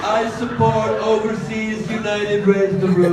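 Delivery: imitating a grand rallying cry; mock Trump supporter. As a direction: parody a political supporter, building to a loud finish. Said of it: "Spoken like a true eloquent Trump supporter".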